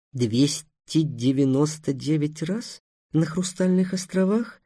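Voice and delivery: quiet, slow, incredulous rasp; hoarse disbelief. Acted. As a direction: rasp it back, unbelieving. Gavar repeats the boast doubtfully. (сиплое недоверчивое переспрашивание)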